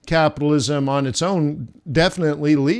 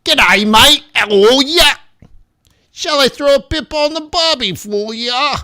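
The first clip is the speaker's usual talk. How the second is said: hammed-up Australian accent, pitched high and loud; over-the-top Australian greeting, much higher and louder; fake Australian accent; 11 semitones higher, 7 dB louder than usual. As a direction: play the cartoon Aussie bloke, big and bright. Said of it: "I have to fake an accent".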